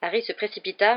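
ordinary delivery, nothing marked

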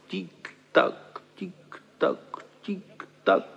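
soft whispered tick-tock like a clock. A narrator's reading. Narrator softly mimics the clock ticking on the wall. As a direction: tap it out quietly like a wall clock.

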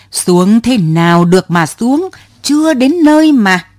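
put-on old woman's indignant cry; shrill protest. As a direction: she yells back from the cyclo seat, rising high.

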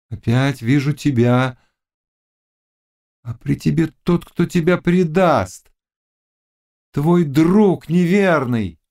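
high, drawn-out prophecy; slow right down on the warning, pitched high; witch prophesying. A narrator's reading. Witch warning the Cossack of betrayal, entranced.

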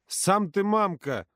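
slow, offended high retort. Acted. Юрка offended by the girls, slow hurt retort. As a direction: sulk it out. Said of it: "обижался Юрка".

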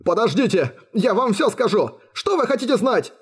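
high, frantic surrender; terrified captive giving in; wailing. A narrator's reading. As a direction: babble in panic, voice way up. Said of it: "взвыл пленник".

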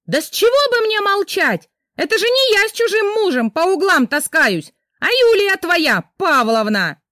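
high, loud furious shouting; wife shouting back at husband. Acted. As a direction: yell back at him defiantly. Wife refuses to be quiet.

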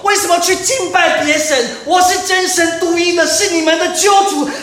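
high, loud, strained shouting; desperate, piercing roar of a birthing mother; God crying out like a woman in labor. Pretend. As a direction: shout at the deaf people as if in labor pains. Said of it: "就是演一下哦，就是产妇的状况哦".

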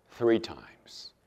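hushed ominous whisper; whispered three times. Retold or imitated. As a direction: drop to a whisper for the prophecy. Jesus foretelling the denial.

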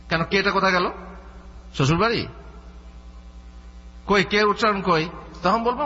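ordinary talk

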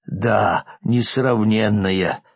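usual speaking voice

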